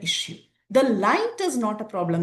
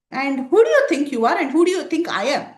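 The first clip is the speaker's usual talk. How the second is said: bold challenge; 4 semitones higher, 7 dB louder than usual. Retold or imitated. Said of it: "very boldly asking him some questions".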